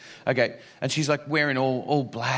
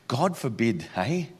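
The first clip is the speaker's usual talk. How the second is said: usual speaking voice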